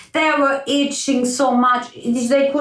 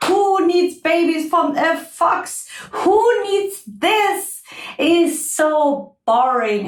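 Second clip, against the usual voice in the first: high-pitched, scornful insect; bored, gloating midge; mocking midge; 9 semitones higher than usual. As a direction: scoff at the fox's troubles.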